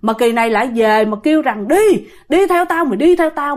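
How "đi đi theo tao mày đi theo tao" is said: fierce ghost command. Acted. Dead sister summoning her elder sister, fierce command. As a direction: demand they follow, voice pitched up. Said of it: "Mà dữ lắm nha".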